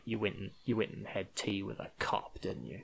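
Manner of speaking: a quiet, nearly breathless accusation; calm. Retold or imitated. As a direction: say it softly, almost without voice, coldly. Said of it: "this one's calm".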